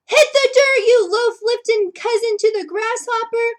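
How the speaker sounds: very high, loud bellow; angry yell. Acted. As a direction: roar it at the fleeing frog. Baker yells at thief.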